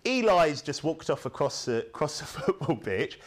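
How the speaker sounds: ordinary delivery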